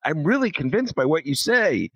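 high, mock-amazed cartoon voice; gullible cartoon character. Imitating cartoon characters won over by Columbus, mock amazement. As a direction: play a wowed, easily convinced cartoon listener. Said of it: "gets them in this cartoon to go".

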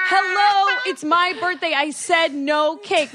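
high, loud retold outburst; mock yell to get through to parents who won't listen; hammed-up exasperation; exasperated protest.

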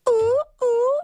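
high whooping bird call. Shown as a demonstration. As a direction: hoot it like a tropical bird. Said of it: "That's awesome".

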